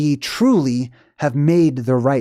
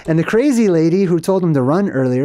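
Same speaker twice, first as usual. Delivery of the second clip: ordinary talk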